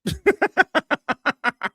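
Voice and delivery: a high, loud cackle of laughter. Real, not acted. Streamer laughing at the clip, loud high cackle. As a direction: cackle out loud, really amused.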